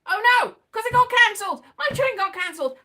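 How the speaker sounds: loud, high, fast cry of dismay; dismayed shout. Retold or imitated. At Crewe station, finding her train cancelled, a loud dismayed shout. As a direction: blurt it out as the bad news lands.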